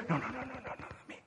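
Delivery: whispered no. Retold or imitated. Jesus voiced correcting disciples.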